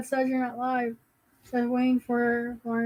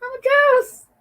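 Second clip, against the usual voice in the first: high, loud mock-ghost cry; spooky joke voice, high and loud; playful ghost; 13 semitones higher, 10 dB louder, slower than usual. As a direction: wail it like a pretend ghost. Joking about looking washed out.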